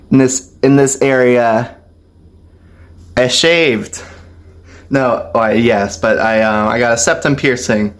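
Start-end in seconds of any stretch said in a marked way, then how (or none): none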